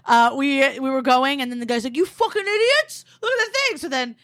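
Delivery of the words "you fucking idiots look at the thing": squawked mock outrage at normal volume; mock yelling. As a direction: imitate someone hollering at cyclists, pitch way up. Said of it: "he thought I was yelling at him".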